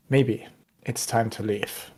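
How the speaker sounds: soft, low and calm, a quiet threat; very calmly. Acted. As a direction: settle it down, calm and firm. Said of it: "very calmly".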